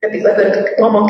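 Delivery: ordinary talk, nothing marked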